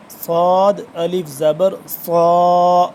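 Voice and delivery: صاد الف زبر صا slow and loud, صاد full and heavy. Shown as a demonstration. Teacher showing a heavy صاد to learners, slow and loud. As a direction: spell صاد الف زبر صا slowly, making صاد thick and full. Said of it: "صاد کو موٹا پر کر کے پڑھنا ہے".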